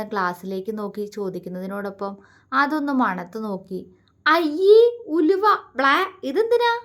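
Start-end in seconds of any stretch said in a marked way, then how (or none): 4.3-6.7 high, loud squeal of disgust; girlish yuck, much higher and louder. A narrator's reading. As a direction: wrinkle your nose at the smell. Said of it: "അവൾ അറപ്പോടെ അവനോട് ചോദിച്ചു".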